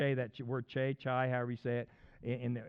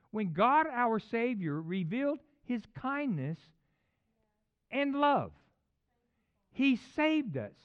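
high, loud, stretched-out phrases; rising preacher's proclamation; emphatic scripture preaching; 9 semitones higher, 7 dB louder, slower than usual. Real, not acted. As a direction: proclaim the verse slowly, phrase by phrase. Reads scripture at full preaching force.

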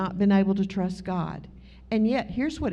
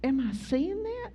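higher, softer half-whispered disbelief; stunned disbelief; 6 semitones higher, 5 dB quieter than usual. Retold or imitated. Driver sees a peacock beside her car, hushed disbelief. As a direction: mutter it like you can't trust your eyes. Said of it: "And I was like".

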